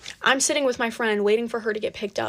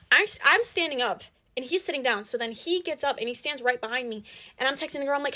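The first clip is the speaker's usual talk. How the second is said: ordinary delivery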